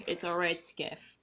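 soft, resigned reply, fading to a breath; quiet disappointment. Acted. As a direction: let it go with a sigh.

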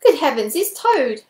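high, loud burst of surprise; astonished exclamation. A narrator's reading. Weasel spots Toad in the tunnel, exclaiming. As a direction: cry out on recognising a lost friend. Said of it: "he exclaimed".

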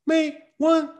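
"May" and "one" on a very high, level pitch; absurdly lifted stress. Shown as a demonstration. Teacher asks if anyone talks so, "May one" pitched high. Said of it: "你会这么说话吗".

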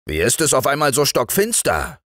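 a touch of a cross husband; irritated husband. A narrator's reading. Angry husband questions wife on entering. (gereizter Ehemann)

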